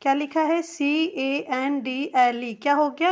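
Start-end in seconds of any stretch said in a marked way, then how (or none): none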